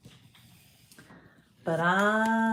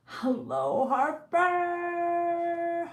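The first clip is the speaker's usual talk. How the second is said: singsong greeting; 10 semitones higher, much slower than usual. Real, not acted. Greeting a viewer by name.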